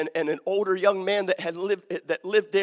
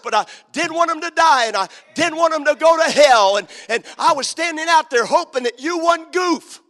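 louder, higher, urgent pulpit voice; impassioned revival preacher telling the story; preaching at full force; 8 semitones higher, 7 dB louder than usual. Acted. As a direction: climb toward the altar call. Preacher building to a climax.

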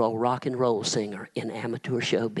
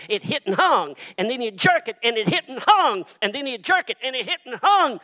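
higher, louder and climbing preacher's cry; full-force preaching; 7 semitones higher, 4 dB louder than usual. Acted. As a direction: build the scourging scene to a roar. Preaching the crucifixion, building.

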